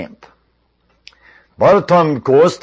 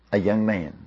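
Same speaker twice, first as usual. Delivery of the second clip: soft, low letdown; deflated; 5 semitones lower, 9 dB quieter than usual. A narrator's reading. Narrator revealing the hoped-for champion to Saul, quietly deflated. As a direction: drop the voice in anticlimax. King sees the boy, let down.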